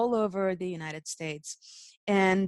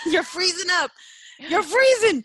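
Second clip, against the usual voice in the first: high, loud laughing; 11 semitones higher, 10 dB louder than usual. Real, not acted. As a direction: laugh at the frozen screen. Laughing telling host he froze.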